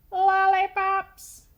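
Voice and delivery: loud, slow, high tooth blurting out candy. Acted. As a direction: blurt it across the classroom. Tooth calls out in class.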